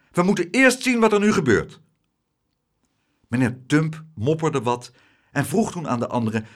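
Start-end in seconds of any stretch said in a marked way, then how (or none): 0.1-1.8 high, loud, firm order; forceful, raised-voice ruling; fierce insistence. A narrator's reading. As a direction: take command like a young captain. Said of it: "riep hij met felle ogen".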